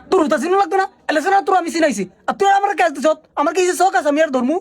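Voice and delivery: ordinary delivery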